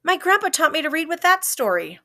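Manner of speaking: high, eager and bright; excited. A narrator's reading. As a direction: rush it out with joy.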